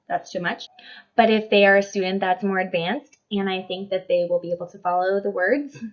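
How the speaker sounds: normal talking voice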